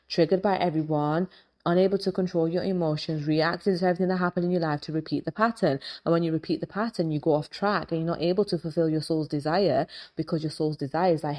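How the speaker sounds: usual speaking voice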